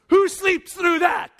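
high, loud, slow exclaim; preacher marvelling at a sleeper, shouting; incredulous shout. Acted. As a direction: shout it in disbelief.

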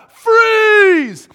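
loud, high, drawn-out shout; shouted command. Acted. PE teacher halting kids, shouted command. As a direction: yell it to halt a whole class. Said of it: "When I shout".

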